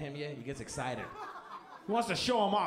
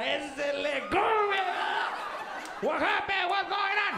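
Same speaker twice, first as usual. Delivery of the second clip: mocking yell; 10 semitones higher, slower than usual. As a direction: yell it jumping up and down. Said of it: "my dad is in the corner jumping up and down".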